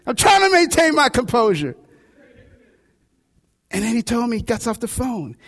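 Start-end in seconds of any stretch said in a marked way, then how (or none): none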